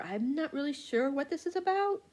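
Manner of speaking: ordinary talk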